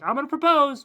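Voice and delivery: hammed-up eager proposal line; excited mock announcement. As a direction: blurt it out like a giddy suitor. Kristoff voiced, excited.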